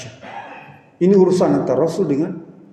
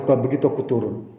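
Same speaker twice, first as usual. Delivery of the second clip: calm Prophet; 6 semitones lower, 5 dB quieter than usual. Acted. The Prophet replies.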